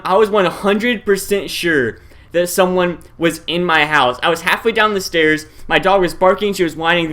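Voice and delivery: ordinary delivery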